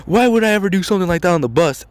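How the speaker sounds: high, fast mock-innocent protest; hammed-up innocent kid, quick and high; indignant denial. Kid denies to coach.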